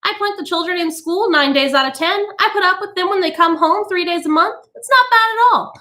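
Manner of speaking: high, chirpy and amused; tittering society woman. A narrator's reading. Mrs Bowles joking to the women about her children, tittering. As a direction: titter through it carelessly. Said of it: "Missus Bowles tittered".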